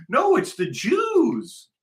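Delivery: high, drawn-out mock wail; mocking imitation. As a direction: parrot them in a high, slow whine. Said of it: "and they'll read it and go".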